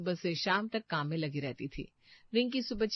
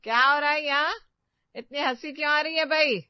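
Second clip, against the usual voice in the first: loud, higher, stern mother-in-law; booming demand; 8 semitones higher, 8 dB louder than usual. Acted. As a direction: catch them out with a loud call. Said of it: "सास की आवाज गूंजी". (गूंजती, कड़क आवाज़ में सवाल)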